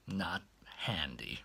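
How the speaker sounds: low, soft and cringing; shrinking back. Retold or imitated. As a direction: pull away while answering. Embarrassed reply to maid.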